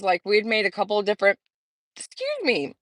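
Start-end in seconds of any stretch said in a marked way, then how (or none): 2.0-2.8 hiccuping mid-sentence; hiccups. Real, not acted. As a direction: hiccup then excuse yourself. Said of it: "it's like I have the hiccups".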